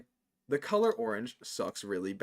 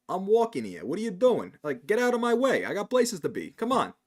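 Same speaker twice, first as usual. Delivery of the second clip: hammed-up Brooklyn Italian telling someone to move; mock New York Italian, higher and louder; New Yorker Italian accent; 5 semitones higher, 4 dB louder than usual. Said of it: "but I can do the accent".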